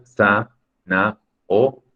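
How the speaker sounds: "sa, na, o" as separate beats; teacher splitting zanahoria into "sa, na, o"; syllable by syllable. Shown as a demonstration. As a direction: count out the syllables sa, na, o with pauses. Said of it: "lo único que nos genera duda es ria".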